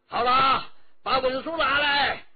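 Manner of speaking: loud, high commanding officer; impatient officer demanding papers; officer's demand. A narrator's reading. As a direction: bark the order at them.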